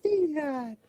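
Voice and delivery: high falling creature call of Fiendrod; very high mock Pikachu cry. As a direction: squeak it like a Pokémon. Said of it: "It's a Pikachu".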